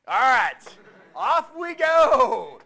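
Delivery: loud, slow and drawn out, rallying the room; big call to get the class going; booming kickoff. Real, not acted. Teacher rallying the lecture hall, loud and drawn out.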